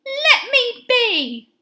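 high, slow, stretched-out plea; shout. A narrator's reading. Benny to the man selling him, slow high shout. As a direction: cry it out high, drawing out each word. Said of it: "shouted Benny".

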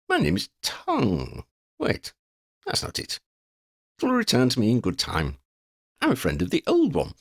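high, wandering vagabond voice; scatterbrained wanderer. Acted. Wanderer muddling his own name to the children, scatterbrained. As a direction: play a muddled old-fashioned eccentric correcting himself.

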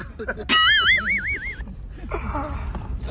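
shrieking laugh; high squealing laugh. Real, not acted.

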